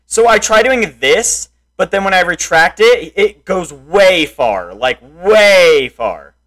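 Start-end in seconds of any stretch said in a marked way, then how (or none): none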